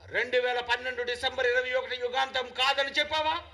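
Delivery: insistent, ringing sermon questions; full-force preaching. Acted. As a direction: challenge the congregation, question after question. Preacher fires challenging questions.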